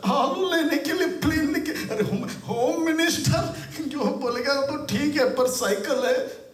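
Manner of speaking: high-pitched acted disbelief; incredulous scolding official. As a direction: scold the pilot as if it is absurd.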